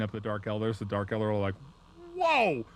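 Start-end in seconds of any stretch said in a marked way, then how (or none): 2.1-2.6 loud, high startled yelp; hammed-up shocked outcry; mock alarm. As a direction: yelp it like you're caught off guard. Dark Eldar startled by attack.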